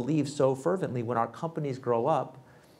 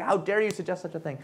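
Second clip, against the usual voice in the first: mock outrage; 8 semitones higher than usual.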